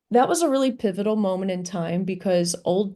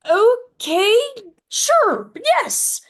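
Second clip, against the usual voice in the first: much higher and louder, excited agreement; eager yes; 8 dB louder, much slower than usual. Retold or imitated. Agreeing to play demos for the producer, eager yes. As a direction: jump at the offer.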